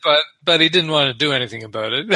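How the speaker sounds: quick, amused mockery through laughter; laughing. Real, not acted. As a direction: rush the punchline while still laughing. Mocking the story while laughing.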